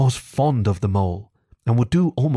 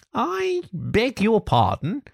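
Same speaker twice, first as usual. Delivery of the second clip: high, slow, pointed query; slow and deliberate; 11 semitones higher, slower than usual. A narrator's reading. Rat questions Toad while chewing a straw, slowly. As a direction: stretch each word out in disbelief. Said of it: "said the Rat slowly as he chewed".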